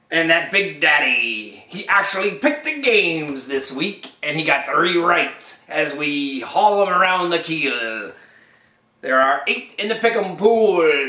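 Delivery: loud, slow growling pirate; hammy old sea dog calling out. Acted. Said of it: "Talking Like a Pirate".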